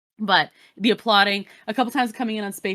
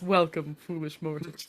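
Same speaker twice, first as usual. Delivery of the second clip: spooky ghost host; 5 semitones lower, 7 dB quieter than usual. Retold or imitated. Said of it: "that opening that starts when it's".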